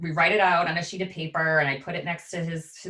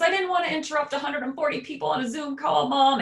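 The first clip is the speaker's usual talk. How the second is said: put-on high, loud wailing kid voice; child's tearful protest; imitating sobbing child; 11 semitones higher, 4 dB louder than usual. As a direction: wail it like a crying boy. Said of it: "he's sobbing".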